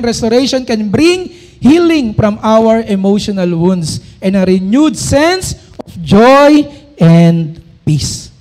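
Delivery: slow, loud, solemn verse reading; scripture reading. Acted. Preacher proclaiming a verse to the congregation, slow and loud. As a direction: proclaim each word of the verse slowly.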